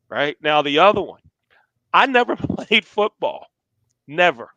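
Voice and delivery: ordinary delivery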